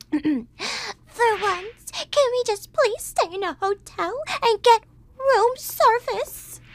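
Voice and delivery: high, whiny fussy worm; Eleanor's voice. Retold or imitated. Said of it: "I imagine her voice like this".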